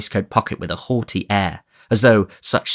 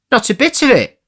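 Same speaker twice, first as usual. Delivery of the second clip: much higher, louder reply; Chitling; 6 dB louder than usual. Acted. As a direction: brush it off.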